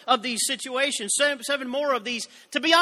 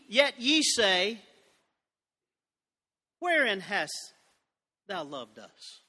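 mocking accusation; 3 semitones lower, slower than usual. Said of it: "they would actually make an accusation".

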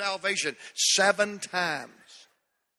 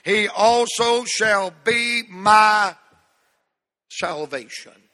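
congregational reading; 3 semitones higher, 6 dB louder, much slower than usual. Acted. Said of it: "Say this first part of verse sixteen".

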